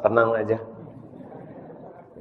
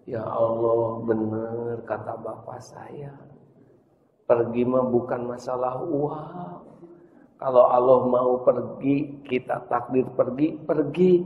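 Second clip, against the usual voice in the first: quiet tearful prayer; 6 dB quieter than usual. Acted. Crying child's quiet prayer.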